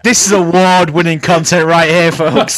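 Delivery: loud mock host boast; sarcastic. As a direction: hype it up ironically.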